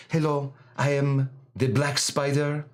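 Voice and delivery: put-on a light French accent, menacing spy; dangerous French woman. Host showing his favorite French villain voice, lightly accented. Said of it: "one of my favorite voices".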